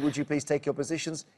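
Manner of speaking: ordinary talk, nothing marked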